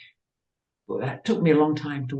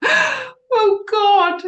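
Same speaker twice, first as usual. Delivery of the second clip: high gasped cry of dismay; gasp, then squeal it way up high; horrified squeak, much louder; aghast; 8 dB louder than usual. Retold or imitated. Reacts in dismay to being told.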